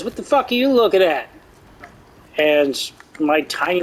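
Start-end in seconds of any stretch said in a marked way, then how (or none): 0.0-1.3 a snappy, raised-pitch threat; quick mock-tough challenge; aggressive guard. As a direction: bark it at him fast like a bouncer picking a fight. Aggressive guard confronts the group.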